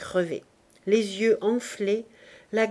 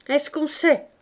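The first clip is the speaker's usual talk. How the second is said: higher, louder shrugging question; lightly voiced Clémence; 6 semitones higher, 5 dB louder than usual. Clémence shrugs off the cold, higher and louder. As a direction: toss it off. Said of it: "reprit Clémence".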